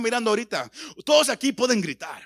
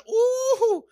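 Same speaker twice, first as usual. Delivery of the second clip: high, loud "uh"; long high-pitched "ooh" at a big noise; imitated reaction whoop; 5 dB louder than usual. Preacher imitating listeners impressed by loud shouting, high whoop. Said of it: "gritemos tan fuerte que".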